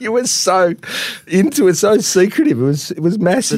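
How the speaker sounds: high voice, helpless with laughter; cracking up while recounting; real laughing fit. Real, not acted. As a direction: struggle to get the words out through laughing. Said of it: "I've gotta compose myself".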